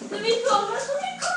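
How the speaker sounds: quick desperate pleading; fast pleading. Real, not acted. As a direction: gabble it out, desperate. Caught in game.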